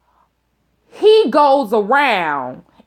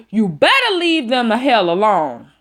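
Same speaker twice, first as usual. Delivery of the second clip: normal talking voice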